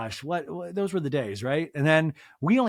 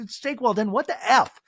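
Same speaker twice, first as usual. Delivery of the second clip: high, loud incredulous outburst; what the F, said in disbelief; mock outrage; 7 semitones higher, 5 dB louder than usual. Real, not acted. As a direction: act scandalized at the idea. Mock outrage at well-done steak.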